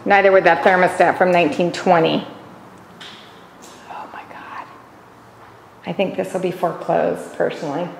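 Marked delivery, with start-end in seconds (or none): 3.9-4.7 breathy disgusted whisper; whispered oh my God. Real, not acted. Disgusted at a dirty house.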